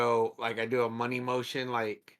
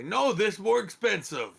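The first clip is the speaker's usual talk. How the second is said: high, loud, slow protest; haggling in broken speech; 9 semitones higher, 5 dB louder than usual. Acted. Player pushing copper on the giant, high loud insistence. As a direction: insist on it loudly in simple words.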